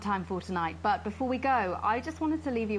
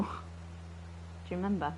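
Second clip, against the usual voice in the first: whisper; 2 semitones lower, 6 dB quieter, much slower than usual. Acted. Creepy confession to Alex.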